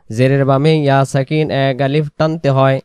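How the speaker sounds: ordinary delivery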